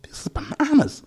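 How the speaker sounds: high-pitched retold disbelief; mock-astonished squeak; amazed reaction. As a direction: go way up high, incredulous. Retelling own amazed reaction.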